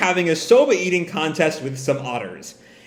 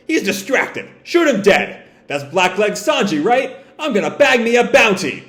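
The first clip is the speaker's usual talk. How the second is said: eager thug voice; 6 semitones higher than usual. Acted. Pirates eager to kill Sanji.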